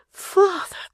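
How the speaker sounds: high alarmed cry of "Father!"; Little Dorrit crying out to her father; trembling cry. Acted. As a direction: call out in distress. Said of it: "cried Little Dorrit, pale and trembling".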